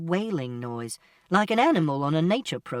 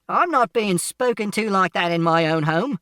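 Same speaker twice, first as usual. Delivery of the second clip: loud, higher, affronted man; indignant shout; 4 semitones higher, 6 dB louder than usual. A narrator's reading. Man objecting in his own home, shouting indignantly. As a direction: shout in outrage at being spoken to so. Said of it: "and Mister Shears shouted".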